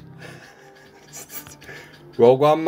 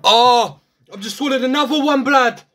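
panicked shout; 6 semitones higher, 4 dB louder than usual. Pretend. Second fake swallow.

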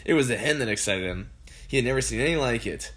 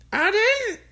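high, louder cackling grandmother; cackling old woman; 6 dB louder than usual. A narrator's reading. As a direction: cackle it out with glee. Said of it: "cackled the old woman".

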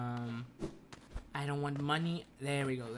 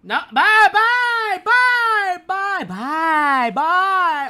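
very high, loud singsong; sing-song goodbye; 16 dB louder, much slower than usual. Pretend. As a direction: taunt them in a shrill singsong. Mocking farewell to enemy.

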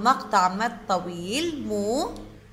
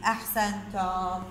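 ordinary talk

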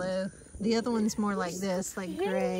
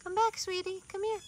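high coaxing call; 11 semitones higher than usual. Real, not acted. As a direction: call the kitten back sweetly, high. Calling kittens back.

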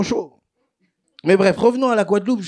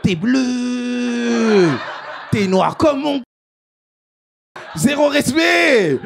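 high, drawn-out teasing exclamations; hammed-up, high-pitched ribbing; mock astonishment; 6 semitones higher, much slower than usual. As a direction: imitate friends hooting in exaggerated shock. Acts out friends' mocking messages.

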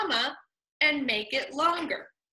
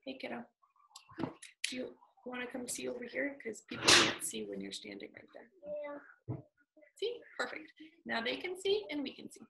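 quiet aside; 13 dB quieter, faster than usual. Real, not acted. Teacher quietly asking a student to move. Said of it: "aside to a child".

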